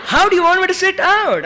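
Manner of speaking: fast, high, laughing protest; fast laughing "how do you want me"; laughing disbelief. Retold or imitated. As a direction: laugh it off in shock.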